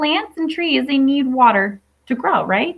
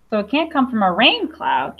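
ordinary delivery

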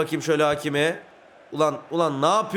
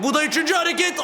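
high, rising thrill as the move comes off; excited shout; 10 semitones higher, faster than usual. Real, not acted. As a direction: call the final move at a shout. Counting moves toward a shot. (heyecanla bağırarak)

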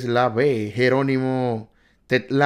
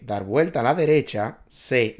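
ordinary delivery

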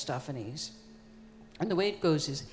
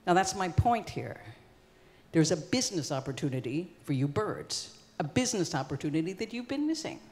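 plain reading voice

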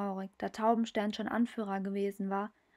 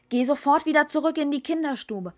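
higher, louder commanding tom over the battle; urgent order; 5 semitones higher, 8 dB louder than usual. Acted. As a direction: order her back to safety, shouting. Mate orders her back mid-battle. (dringender Befehl, höher und laut gerufen)

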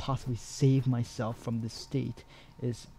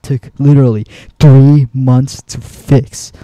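much louder, leaning hard on THREE; exasperated outburst; 19 dB louder than usual. Real, not acted. Gamer complaining that a fix took months, exasperated outburst. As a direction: burst out in real frustration. Ranting about slow bug fixes.

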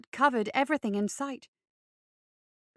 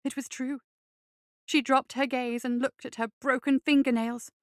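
usual speaking voice

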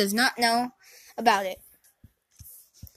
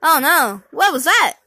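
loud startled cry; alarmed; 3 semitones higher, 8 dB louder than usual. Pretend. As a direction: react to a sudden noise. Toy reacts alarmed.

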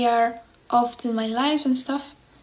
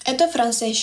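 usual speaking voice

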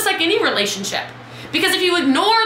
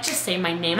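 low mock plea; 8 semitones lower, 5 dB quieter than usual. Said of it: "Melissa's like".

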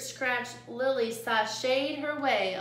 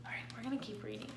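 quick, hushed whisper; 14 dB quieter, much faster than usual. Real, not acted. Quick aside to the class.

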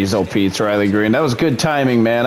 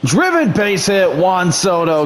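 higher, rising call of the base hit; excited hit call; 8 semitones higher, slower than usual. Acted. Announcer calling the Soto hit, excited. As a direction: sell the hit like a broadcaster.

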